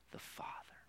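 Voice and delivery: whispered the Father; whispered repetition of the Father. Real, not acted. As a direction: breathe the Father softly. Repeating the word, fading.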